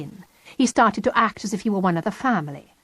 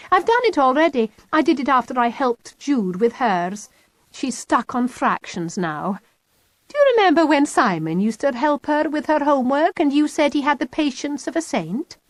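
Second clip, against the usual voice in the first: put-on higher bright girl, pointed; Kitty's voice; 7 semitones higher than usual. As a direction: needle Mum with cheerful hints.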